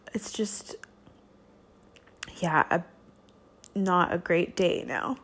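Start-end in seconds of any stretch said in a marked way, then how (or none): 0.1-0.7 whispered. Real, not acted. Sigh, voice gives out.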